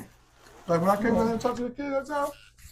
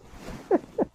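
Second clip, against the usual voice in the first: a high unvoiced laugh; high breathy laugh; 13 semitones higher than usual. Real, not acted. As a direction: burst out laughing at your own joke. Laughs after impression.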